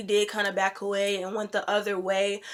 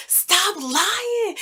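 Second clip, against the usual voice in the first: high-pitched mock yell; retold scream; 10 semitones higher, 4 dB louder than usual. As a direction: act out screaming at a liar, not full force. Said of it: "just started screaming in her face".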